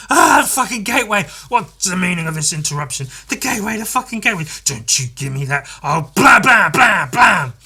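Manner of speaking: high, loud furious rant; bursting in, yelling at the board; furious boss. Retold or imitated.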